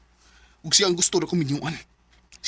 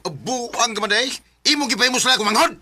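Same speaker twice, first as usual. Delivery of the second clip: sharp raised scolding; angry scolding; 6 semitones higher, 3 dB louder than usual. Acted. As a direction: rebuke him hard. Brother confronts sister's lover. (masuko nga pagbadlong)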